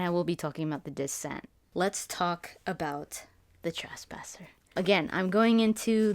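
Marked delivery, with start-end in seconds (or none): 1.7-4.5 whisper. Real, not acted. Whispered slow topic intro.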